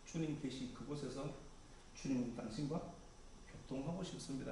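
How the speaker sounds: soft, hushed and earnest prayer; tender confession. Retold or imitated. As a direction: confess your longing quietly to the Lord. Disciples' confession voiced as prayer.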